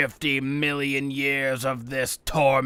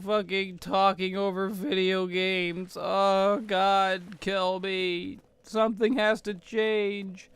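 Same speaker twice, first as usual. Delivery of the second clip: higher, fading whiny lament; mock despair; 6 semitones higher than usual. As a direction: moan it out like a defeated ghost. Despairing after meltdown.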